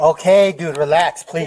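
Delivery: loud, pressing plea to settle down; urgent appeal. Real, not acted. Officer begging an armed man to relax, urgent and loud. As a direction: raise your voice, urging them to relax.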